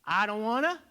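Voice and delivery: loud refusal. Shown as a demonstration. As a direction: belt the refusal out. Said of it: "A little weaker than the".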